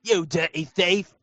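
slow, put-on guard voice; slowed imitation of a game guard; mock guard line. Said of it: "it's the same thing".

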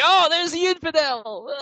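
very high, slow mock guard cry; drawn-out high game-guard shout. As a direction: ham up a soldier spotting the intruder.

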